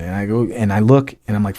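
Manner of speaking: usual speaking voice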